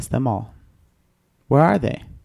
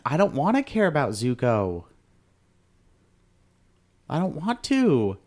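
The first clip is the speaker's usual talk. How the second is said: reluctant whine; 4 semitones higher than usual. Real, not acted. Reluctant protest about caring.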